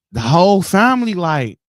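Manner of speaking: ordinary talk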